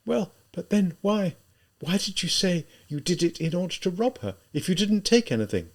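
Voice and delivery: high, lighter voice of an anxious woman; high-pitched, puzzled urgency; quick, anxious question. A narrator's reading. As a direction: press for an answer, grasping at hope. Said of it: "she asked quickly".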